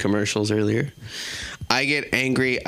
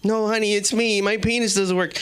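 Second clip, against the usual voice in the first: higher, pleading husband voice; whiny husband; 9 semitones higher than usual. Acted. Husband pleads with wife about his penis, higher and whiny. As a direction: plead the lie to her.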